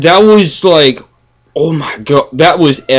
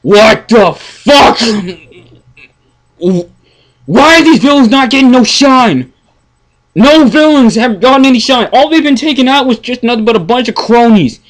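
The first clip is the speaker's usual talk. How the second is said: exasperated rant; 9 semitones higher than usual. Real, not acted. Fan complaining villains get no shine, exasperated high rant. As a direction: vent the frustration.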